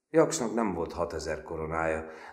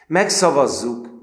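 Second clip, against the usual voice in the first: louder, much higher and slow, with a rising end; drawn out, rallying agreement; slow, loud echo of the vote; 10 semitones higher, 12 dB louder than usual. A narrator's reading. Narrator echoes the vote louder.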